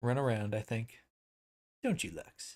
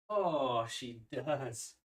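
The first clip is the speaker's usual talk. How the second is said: normal talking voice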